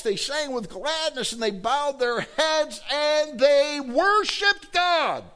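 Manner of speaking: climbing high and slowing, almost sung; rising ecstatic preaching. Acted. Preacher to congregation, slow, soaring and ecstatic. Preacher describing joyful worship.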